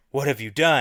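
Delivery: mildly dramatic horrified gasp-line; a touch of horror, not fully dramatic; understated horrified. Acted. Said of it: "I need to be more dramatic about that".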